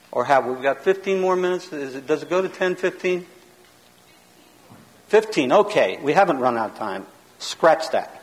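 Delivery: ordinary talk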